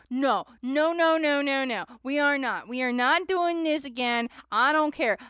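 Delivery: no no no, loud and high, shutting it down; firmly refuse, voice up and loud; emphatic loud refusal. Retold or imitated. To the chat about the fake death reading, loud insistent refusal. Refusing a repeat tarot scare.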